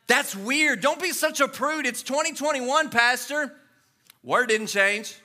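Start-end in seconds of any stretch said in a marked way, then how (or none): 0.1-3.5 scoffing. Retold or imitated. Mock scoffing congregant.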